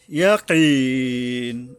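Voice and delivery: "ইয়াকিন" with the last vowel held very long before stopping; three-alif elongation. Shown as a demonstration. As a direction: stretch the "ki" of "ইয়াকিন" slowly, then stop on the n. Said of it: "তিন আলিফ টাইনা থামতে হবে".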